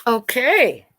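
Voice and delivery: plain reading voice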